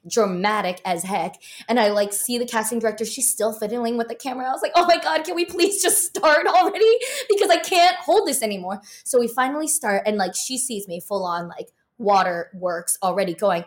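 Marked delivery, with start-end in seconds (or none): 4.8-8.8 high, louder, urgent begging; desperate plea. Retold or imitated. Silently begging the casting director to start, desperate. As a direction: beg them to start before it spills. Waiting on the fixed camera.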